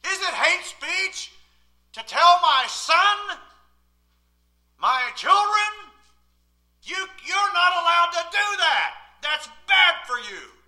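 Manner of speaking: slow, loud, high preaching; slow pulpit preaching. Acted. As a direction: drag out each phrase from the pulpit. Preaching at full force.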